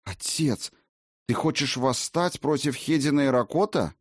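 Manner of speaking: astonished daughter questioning her father; stunned Valkyrie. A narrator's reading. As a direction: ask it in shocked disbelief, pitch lifting. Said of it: "потрясённо раскрыв рот".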